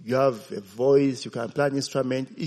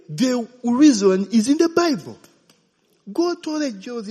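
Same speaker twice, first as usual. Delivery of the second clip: preaching emphasis; 10 semitones higher, 6 dB louder than usual. Real, not acted. Preaching climax.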